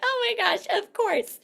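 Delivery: high, bright-voiced quoted friend; laughing friend. A friend laughing it off about the random Mary Kay caller. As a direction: pitch it way up like an amused friend. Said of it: "They're like".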